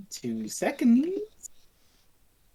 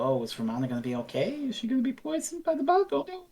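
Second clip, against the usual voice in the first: lightly hammed high fretful gasp; mock-anxious audience voice, quick; mock worried viewer; 7 semitones higher, 6 dB quieter, faster than usual. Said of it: "a cliffhanger to make you go".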